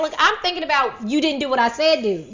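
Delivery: a loud, hammed-up parent snapping; exasperated outburst. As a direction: push back hard, fed up. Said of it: "And I'm like".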